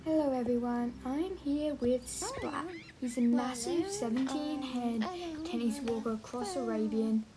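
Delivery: soft, close voiceover read; voiceover narration. Acted. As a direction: narrate the ride quietly.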